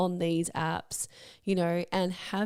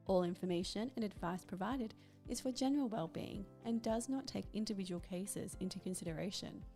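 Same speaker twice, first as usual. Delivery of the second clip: much softer, measured announcer read; disclaimer read; 16 dB quieter than usual. Acted. Podcast host reading the legal disclaimer to listeners, formal read. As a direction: read out the small print, quiet and formal.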